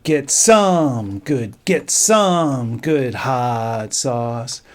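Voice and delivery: slow, drawn-out words with accents; slow counting of the foods with stress; stretched emphasis. Shown as a demonstration. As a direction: spell the rhythm out slowly, leaning on accents. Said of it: "do that emphasis".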